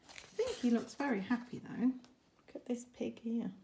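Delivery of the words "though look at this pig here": ordinary delivery, nothing marked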